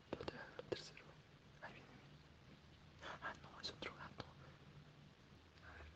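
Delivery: soft late-night whisper; real whisper. Real, not acted. Up at night watching cats, whispering quietly. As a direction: whisper so nobody wakes.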